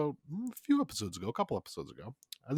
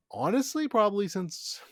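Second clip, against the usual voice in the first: bursting out much higher and louder; gushing with sudden excitement; excited; 9 semitones higher, 6 dB louder than usual. Real, not acted. Excited gush about the movie.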